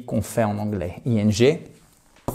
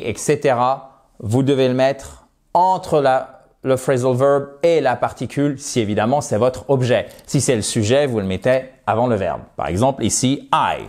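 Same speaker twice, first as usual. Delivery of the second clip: ordinary delivery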